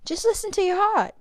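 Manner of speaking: high, gentle mother's advice; tender. Acted. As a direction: reassure your little one.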